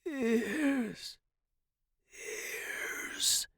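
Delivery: hoarse, drawn-out dying whisper. A narrator's reading. Dying professor to student, whispered. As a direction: breathe it out hoarsely. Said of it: "he whispered".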